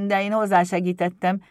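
loud, slightly confused imp; slightly muddled kobold. A narrator's reading. Pumukli admits he helped, loud, mildly flustered. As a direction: own up in a lightly voiced kobold voice. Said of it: "mondta a kobold kissé zavarosan".

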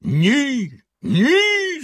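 villain's high-pitched shout of refusal; hollering. A narrator's reading. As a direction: bellow a refusal, climbing sharply. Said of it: "загорлав синя борода".

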